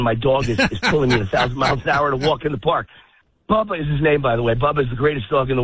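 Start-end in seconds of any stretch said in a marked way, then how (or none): 0.0-2.9 breathless, with a laugh; winded, being pulled along; out of breath. Real, not acted. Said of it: "I'm out of breath again".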